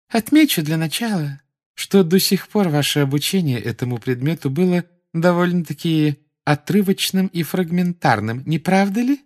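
high, saccharine lecturing; sugary Umbridge voice. A narrator's reading. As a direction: lecture with condescending sweetness.

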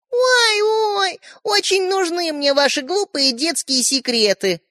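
high, mocking sing-song scoff. A narrator's reading. Ariша mocking her little brothers. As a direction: sneer at the silly secrets. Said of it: "насмешливо произнесла".

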